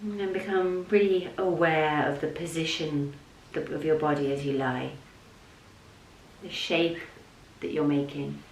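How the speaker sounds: soft, level voice with long pauses; unhurried, hushed yoga nidra guidance; guided meditation. Real, not acted. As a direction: guide them gently down into rest.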